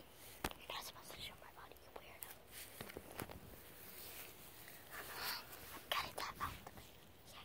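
hushed arguing whisper; whispered squabble. Real, not acted. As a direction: bicker in a whisper. Whispered argument with sister.

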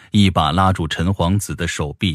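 plain reading voice

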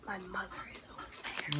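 hushed unvoiced whisper. Real, not acted. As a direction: whisper it as a secret aside. Joking aside to husband.

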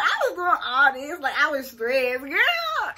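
loud, high amused exclaiming; laughing disbelief. Real, not acted. Laughing at her old hair.

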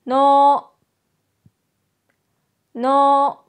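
の held long and level, tongue on upper gum; pronunciation model. Shown as a demonstration. Teacher models の for learners to repeat, pronunciation model. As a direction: say の as one firm, sustained syllable. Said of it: "舌は上の歯茎につけます".

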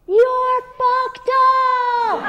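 high, sweet angel voice; sing-song heavenly coo; sweet angelic voice. Retold or imitated. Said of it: "whispered to me in a sweet angelic voice".

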